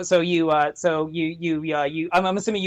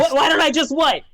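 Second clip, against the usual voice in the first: indignant imp; 6 semitones higher than usual. Acted. Imp cutting back at a player, indignant. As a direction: throw it back as a testy imp.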